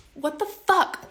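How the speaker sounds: sudden loud, high-pitched what the fuck; exasperated outburst. Real, not acted. Woman venting to viewers about being ghosted, loud exasperated outburst. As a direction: blurt it out in angry disbelief. Furious about date unmatching her.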